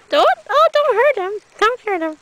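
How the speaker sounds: high-pitched, worried plea; alarmed pleading. Real, not acted. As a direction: plead with a pet in sudden alarm. Pleading as cat attacks snake.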